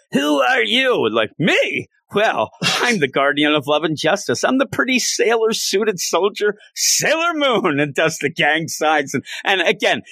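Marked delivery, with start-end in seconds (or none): 0.1-1.1 high squeaky villain question; a hammed-up shocked monster; lightly voiced villain demanding; mock villain. 1.4-7.8 put-on high, proud magical girl announcement; hammed-up heroine intro. Sailor Moon's grand introduction acted.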